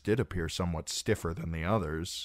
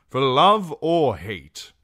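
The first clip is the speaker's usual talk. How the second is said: very loud, slow, raised-pitch rebuttal; angry retort; 8 semitones higher, 12 dB louder, slower than usual. A narrator's reading. Knight rejects the lord's pity, loud and heated. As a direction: throw the pity back forcefully.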